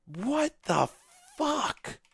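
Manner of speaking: high, disbelieving what the fuck; shocked outburst. Real, not acted. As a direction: blurt it in pure disbelief.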